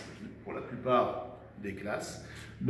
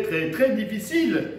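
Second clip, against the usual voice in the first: « très » répété et martelé, plus haut et plus fort; insistance; 8 semitones higher, 7 dB louder than usual. Shown as a demonstration. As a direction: hammer each « très », high and loud. Insiste sur la difficulté de la lettre. (insistant, « très, très » martelé)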